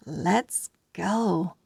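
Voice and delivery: normal talking voice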